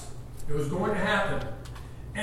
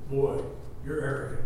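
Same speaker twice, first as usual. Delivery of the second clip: 1 low scornful neighbor; 6 semitones lower, 7 dB quieter than usual. Retold or imitated. Neighbor sneering at Abraham.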